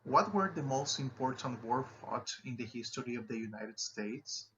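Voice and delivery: quick read with the pitch dropping at the end; falling intonation on a wh-question; falling wh-question tune. Shown as a demonstration. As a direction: let the question fall on States. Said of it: "see and listen how they are, appropriately intonated".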